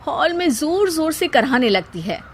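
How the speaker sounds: plain reading voice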